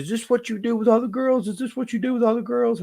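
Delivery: high mocking voice of the upset girlfriend. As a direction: mimic her accusing pitch, a bit hammed-up. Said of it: "And she says".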